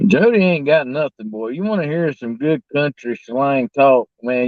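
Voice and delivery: normal talking voice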